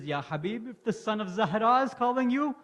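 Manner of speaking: loud, high furious outburst; rising fury, voice climbing hard; furious wife. Acted. Wife scolding Habib for worrying about her, furious. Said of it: "His wife gets furious and says".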